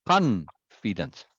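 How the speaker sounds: "confidence" with a long, heavy CON; stress on first syllable. Shown as a demonstration. Showing another emphasis to the student, CON-fidence stressed first. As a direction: stretch CON in "confidence", then drop off. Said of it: "When I emphasize the third syllable, or".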